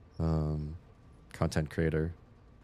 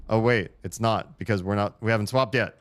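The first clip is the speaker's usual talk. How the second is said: louder, startled oh wait; higher, louder surprised correction; sudden realization; 5 semitones higher, 5 dB louder than usual. Real, not acted. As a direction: catch your own mistake out loud. Realizes mistake mid-puzzle.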